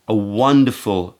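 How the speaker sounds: usual speaking voice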